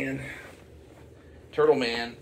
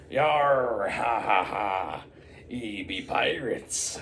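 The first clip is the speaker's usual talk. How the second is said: pirate voice; much slower than usual. Retold or imitated. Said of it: "talk like an actual pirate".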